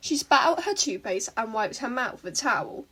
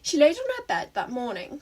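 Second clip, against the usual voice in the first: plain reading voice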